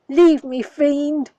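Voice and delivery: loud, furious yell; shouting in a rage. Acted. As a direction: drive the fiend away with your voice. Calion yells at the child.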